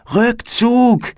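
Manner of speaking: high, urgent shout of retreat; shouted command. A narrator's reading. Felix to friends as the fire wins, urgent shout. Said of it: "rief Felix".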